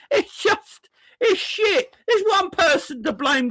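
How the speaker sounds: high, laughing disbelief; exasperated laugh. Real, not acted. Fan mocking critics of Mo, laughing high.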